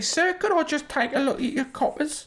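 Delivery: high squeaky Cockney street urchin; old london cockney. Acted. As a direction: Victorian urchin Cockney accent.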